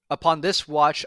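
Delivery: usual speaking voice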